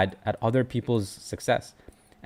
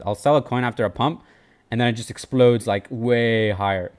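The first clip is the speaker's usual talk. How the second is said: ordinary talk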